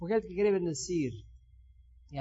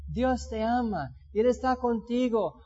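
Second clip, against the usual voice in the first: ordinary talk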